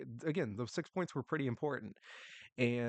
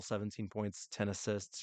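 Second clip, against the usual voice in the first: ordinary delivery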